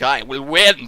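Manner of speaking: ordinary delivery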